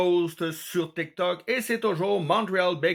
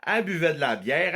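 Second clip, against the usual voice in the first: ordinary delivery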